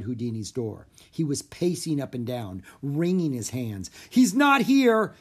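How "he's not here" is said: high, loud, drawn-out wail; panicked cry. A narrator's reading. Theater manager finds the star missing, crying out. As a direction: cry it out in alarm. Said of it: "he cried".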